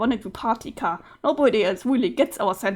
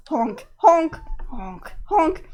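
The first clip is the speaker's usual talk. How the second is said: clown honks; 3 semitones higher, much slower than usual. Acted. Clown character honking.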